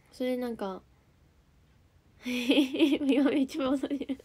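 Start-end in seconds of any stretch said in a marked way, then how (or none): 2.2-4.2 real giggle breaking into the words; laughing. Real, not acted. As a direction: laugh through the admission. Laughs at own spacing out. (笑いながら)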